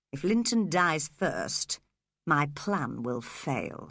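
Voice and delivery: lightly voiced cold schemer; scheming villain. A narrator's reading. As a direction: plot it coldly under your breath. Heathcliff scheming aside.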